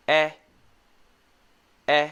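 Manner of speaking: "E" as "eh" in ben; brief isolated "eh", not "ee"; short E sound. Shown as a demonstration. Teacher reviewing short E for learners, isolated "eh". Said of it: "We also have the E sound".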